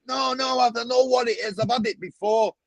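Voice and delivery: high insistent put-on voice; umbrella seller. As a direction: insist he's tried it. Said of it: "He went".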